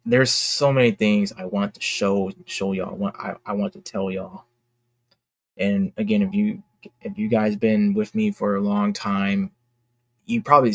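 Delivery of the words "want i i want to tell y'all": usual speaking voice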